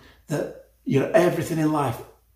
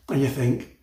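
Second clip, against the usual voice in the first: usual speaking voice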